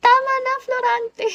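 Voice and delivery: high, fast mock dramatic plea with a gasp; acting Florante et Flora. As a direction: gasp then beg her to stop. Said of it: "yung nag-ano kami na Florante at Flora". (kunwaring madramang pakiusap na may hingal)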